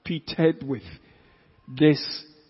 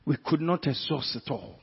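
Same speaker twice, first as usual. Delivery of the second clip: usual speaking voice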